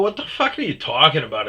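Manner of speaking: angry retort. Retold or imitated. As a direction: fire it back in disbelief. Retold angry confrontation at work.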